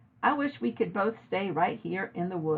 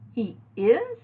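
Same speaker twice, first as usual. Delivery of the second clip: rising astonished question; disbelieving he is; girl stunned by the news; 4 semitones higher, 5 dB louder than usual. A narrator's reading. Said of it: "I said in astonishment".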